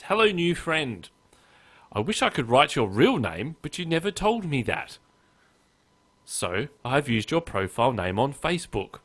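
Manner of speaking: put-on, lilting pen-pal voice; mock email reading. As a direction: read the spam out with exaggerated lilting friendliness. Reading a spam email aloud.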